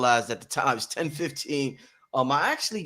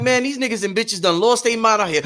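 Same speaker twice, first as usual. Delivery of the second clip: higher and louder, laughing it out; laughing exasperation; 7 semitones higher, 5 dB louder than usual. Retold or imitated. Host voices himself venting on the show, laughing. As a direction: blurt it with a laugh. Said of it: "I'm like".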